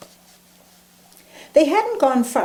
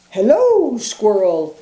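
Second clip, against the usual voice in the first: hooting owl; 5 dB louder than usual. Acted. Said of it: "hooted the owl".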